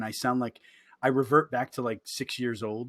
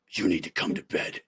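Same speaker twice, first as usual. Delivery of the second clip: lower, stern wife voice; imitating wife; 8 semitones lower than usual. Wife at the stairs telling him to come to bed. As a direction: order him to bed, low and firm. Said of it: "She's like".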